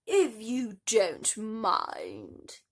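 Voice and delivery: slow, loud haughty boom. Acted. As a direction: boom over the daughters. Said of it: "boomed their mother".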